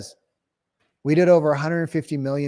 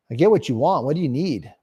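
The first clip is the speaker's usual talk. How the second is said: usual speaking voice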